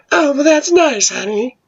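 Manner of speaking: very high, sugary fake-nice voice; high-pitched phony interest; insincere, dismissive mother. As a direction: coo it high without meaning it. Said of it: "you can tell by the voice, yeah, she doesn't mean it".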